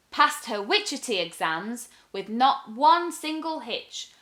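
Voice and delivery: usual speaking voice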